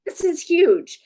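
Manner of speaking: high, loud delighted squeal; thrilled. Retold or imitated. Showing how her upbeat style sounds, thrilled. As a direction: squeal it like the best news ever. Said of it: "in my personality style, that's like".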